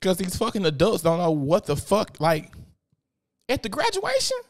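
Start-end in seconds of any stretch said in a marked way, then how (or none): 3.5-4.4 very high, incredulous question; can you believe it, squeaked up high; disbelief. Real, not acted. Vlogger mocking adults fighting at a graduation, incredulous.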